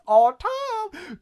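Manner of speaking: high, long-drawn holler; hammed-up high cry; mock wailing call. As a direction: imitate a yearning call, stretching the name. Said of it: "He just goes".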